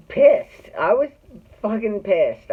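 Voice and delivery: loud, high, angry burst; furious. Real, not acted. Telling the reporter how pissed she was, loud and angry. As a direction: spit out how pissed you were. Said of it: "I was furious".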